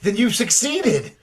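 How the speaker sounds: thrilled, laughing assistant; delighted laugh. Acted. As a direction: burst out in delight as it works. Assistant elated at the operation.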